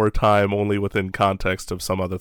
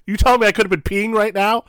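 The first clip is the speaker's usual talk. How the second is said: much higher, louder mock disbelief; incredulous outrage; 12 semitones higher, 5 dB louder than usual. Co-host retells his disbelief at the intermission, higher and louder. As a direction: play up the shock of the missed break.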